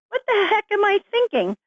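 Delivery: amused, exaggerated what-on-earth; incredulous disbelief. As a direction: pitch way up in mock disbelief, drawing out absurd. Disbelieving at her own thought.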